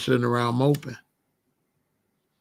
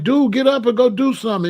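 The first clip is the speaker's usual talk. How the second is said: urging homeboy; 10 semitones higher, 5 dB louder than usual. Retold or imitated. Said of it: "they'd be like".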